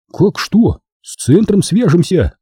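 high, lively comeback; eager retort. A narrator's reading. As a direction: answer as if it were obvious. Genka answers eagerly, acted dialogue.

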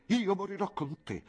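voce più acuta, appassionata; Clelia innamorata. Acted. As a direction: dichiaralo con slancio disperato. Clelia swears to die with him. (Clelia appassionata al suo amato)

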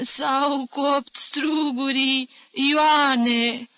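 put-on slow, high old-woman whine; muffled whimper. A narrator's reading. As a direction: whimper it drawn out to Ion. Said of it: "apoi scânci șoptit, înfundat".

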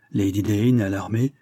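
usual speaking voice

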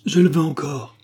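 high, loud vehement outburst; vehement cry. Acted. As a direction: fling it back in defiant despair. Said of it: "s'écria-t-il avec véhémence".

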